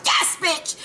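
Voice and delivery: high, gleeful exclamation; excited cheer. Retold or imitated.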